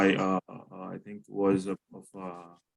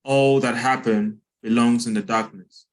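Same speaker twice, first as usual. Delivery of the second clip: measured poem recital with long pauses; poem reading; 2 semitones higher, 5 dB louder than usual. Acted. Poet reads poem on a plane crash to an audience, solemn recital. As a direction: read it out firmly, line by measured line.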